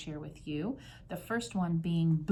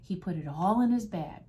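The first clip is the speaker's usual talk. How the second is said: ordinary delivery, nothing marked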